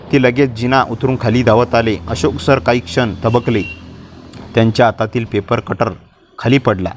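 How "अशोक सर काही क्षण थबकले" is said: usual speaking voice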